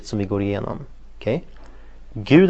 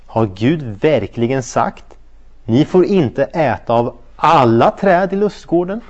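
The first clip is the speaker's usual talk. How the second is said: Satan's question; 3 dB louder, slower than usual. Acted. Satan twisting God's words to Eve, slow and sly. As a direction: drag it out like a tempting serpent. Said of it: "Han sa så här".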